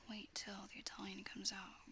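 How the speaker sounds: ordinary delivery, nothing marked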